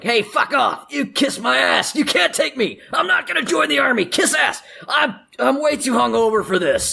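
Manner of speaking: high-pitched indignant shouting; kicking and screaming. Acted. Half-orc to press gang, high screaming protest. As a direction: yell it high, struggling against being dragged off. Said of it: "he's kicking and screaming".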